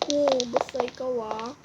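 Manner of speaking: ordinary talk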